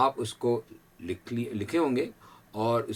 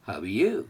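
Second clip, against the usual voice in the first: "you" in "How are you?" with a slight rise; light stress on you; 4 semitones lower, much slower than usual. Shown as a demonstration. Teacher models for students the rise on "you". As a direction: lift "you" a little at the end. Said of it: "यू के ऊपर हल्का सा हमने stress करना है".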